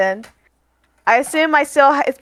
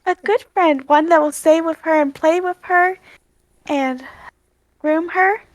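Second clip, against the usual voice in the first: raised, coaxing voice; gentle Aria; 5 semitones higher than usual. Acted. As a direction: coax a shy animal kindly. Aria coaxes the monkey.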